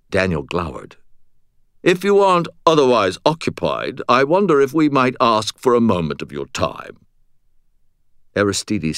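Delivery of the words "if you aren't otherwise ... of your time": higher, haughtily polite British; obnoxious British accent. A narrator's reading. Butters requesting Aristides's time, haughty British accent. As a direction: make a grand British request. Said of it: "absolutely obnoxious British accent".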